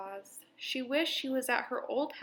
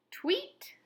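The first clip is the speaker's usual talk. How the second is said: high chirpy tweet like a little bird; 13 semitones higher than usual. A narrator's reading. Bird greets new cat with a high tweet. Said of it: "said a bird".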